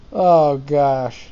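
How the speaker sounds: ordinary delivery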